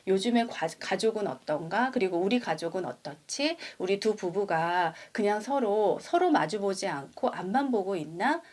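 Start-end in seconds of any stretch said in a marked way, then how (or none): none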